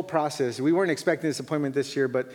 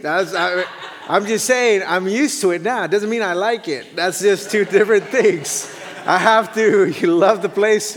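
laughing while talking; 5 semitones higher, 7 dB louder than usual. Real, not acted. Joking about the heat, laughing.

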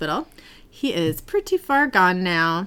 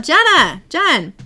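calling out high and loud for someone; mock yell; 7 semitones higher, 7 dB louder, slower than usual. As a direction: imitate someone yelling a name through a house. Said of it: "Dean yells out".

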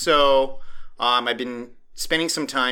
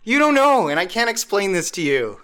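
exasperated retort; 9 semitones higher, 4 dB louder than usual. Retold or imitated. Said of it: "I'm like".

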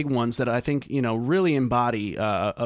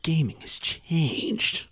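slow, drawn-out failed Snake voice; Snake impression; 4 semitones higher, much slower than usual. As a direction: attempt old Snake, slow. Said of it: "That was not a very good Snake impression".

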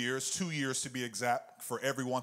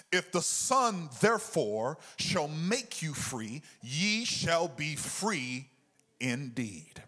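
rising, louder preaching cadence; 4 semitones higher, 3 dB louder than usual. Acted. Pastor preaching the truth to his church, full fervour.

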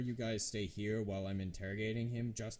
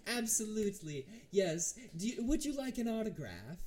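high, loud, delighted villain; theatrical, smug and pleased; smiling necromancer; 8 semitones higher, 4 dB louder than usual. Acted. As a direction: gush like a star greeting a fan. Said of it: "he just smiles and goes".

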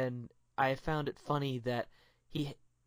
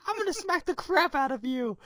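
mock threat; 3 dB louder than usual. Deadpool threatens Colossus.

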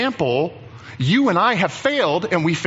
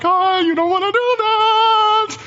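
high, loud, hammed-up panic; very upset Moses; 5 dB louder than usual. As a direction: wail a frantic warning, pitched way up. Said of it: "he's very upset".

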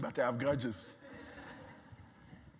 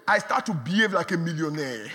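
builds louder to a shouted finish; rising preaching; 4 semitones higher, 8 dB louder than usual. Acted. Preacher telling the church to talk with faith, building to shout. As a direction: climb to a triumphant shout. Preaching about talking like the rich.

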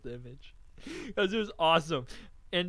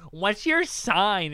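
put-on girl's question; 5 semitones higher, 9 dB louder, much slower than usual. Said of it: "the girl's like".

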